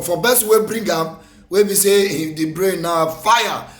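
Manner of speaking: ordinary delivery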